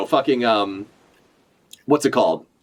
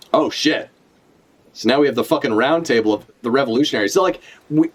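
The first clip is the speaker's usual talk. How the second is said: ordinary delivery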